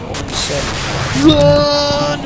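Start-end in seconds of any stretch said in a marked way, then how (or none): 1.2-2.2 high frantic yell; panicked shout. Real, not acted. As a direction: scream it in a panic. Panicked in horror game.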